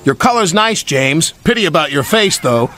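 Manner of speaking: mocking freight car. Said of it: "said a freight car".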